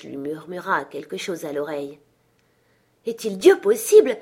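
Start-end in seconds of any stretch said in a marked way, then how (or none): 3.1-4.2 loud, high gasped-out question; astounded cry. A narrator's reading. The old gentleman hears Scrooge's whisper, astounded cry. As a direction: exclaim as if choking with astonishment. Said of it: "s'écria ce dernier comme suffoqué".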